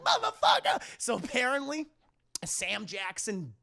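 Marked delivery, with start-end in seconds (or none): 0.0-0.8 very high, loud curse; mock outraged yell. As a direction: yelp the swear, hammed-up. Mimics arm cut off, swearing.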